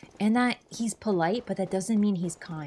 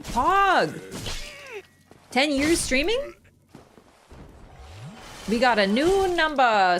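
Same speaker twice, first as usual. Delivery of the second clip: higher, louder fake hype; sarcastic; 7 semitones higher, 5 dB louder, slower than usual. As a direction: cheer it with obvious sarcasm. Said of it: "Sorry, I'm being sarcastic".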